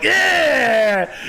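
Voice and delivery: high, drawn-out maniac yell; madman. Retold or imitated. Acting out the madman in a tattoo, manic mock yell. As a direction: imitate a crazed tattoo figure yelling. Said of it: "like, a madman in the middle".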